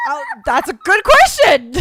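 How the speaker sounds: high, loud laughing retort. Real, not acted. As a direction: dodge the question while giggling. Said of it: "And I was like".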